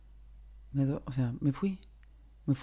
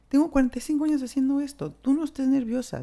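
higher, louder, fast breezy reassurance; jovial doctor; 10 semitones higher, 5 dB louder than usual. Retold or imitated. Old anesthesiologist joking to calm nervous patient, higher and fast. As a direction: brush off her nerves like an old pro.

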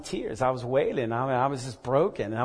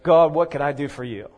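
mock proud question; 6 dB louder than usual. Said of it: "those that ask the question".

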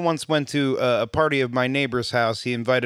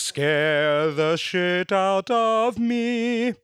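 spooky voice; 6 semitones higher, much slower than usual. Pretend. Slow spooky voice.

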